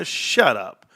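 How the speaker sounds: normal talking voice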